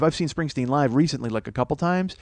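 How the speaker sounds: ordinary delivery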